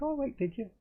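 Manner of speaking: high-pitched, taken aback; retold surprise. To a man who made a film, mock surprise. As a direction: re-enact a surprised question, pitched right up. Retelling his reaction to filmmaker's claim.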